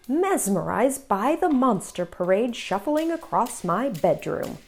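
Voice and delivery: ordinary delivery, nothing marked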